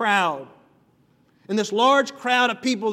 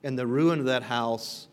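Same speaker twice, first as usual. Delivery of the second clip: calm, measured Bible reading, softer than the preaching; scripture reading; 5 semitones lower, 3 dB quieter than usual. Acted. Reads the scripture passage aloud.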